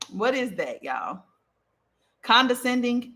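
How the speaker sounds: usual speaking voice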